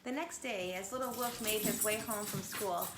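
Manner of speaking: usual speaking voice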